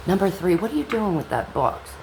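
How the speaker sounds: usual speaking voice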